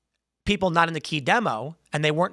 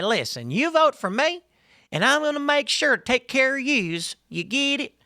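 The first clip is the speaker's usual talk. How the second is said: mock Hillary Southern accent; 8 semitones higher than usual. Candidate promising locals to care for them, mock Southern accent. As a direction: imitate a pandering Southern accent, pitched up. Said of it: "she talked like this".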